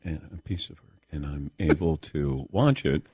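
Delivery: dropped low and soft. Real, not acted. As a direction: bring it right down. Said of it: "Lower, [name]".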